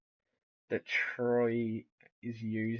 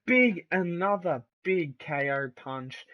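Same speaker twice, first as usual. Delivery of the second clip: excited shout; 7 semitones higher, 6 dB louder than usual. Acted. Big move call.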